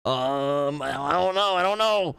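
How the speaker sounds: high, rising squeaky I don't know; flustered mock helplessness. Mocking the conservative in the meme, high helpless squeak. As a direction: squeak it out, utterly lost. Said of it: "the Patrick Wallet meme".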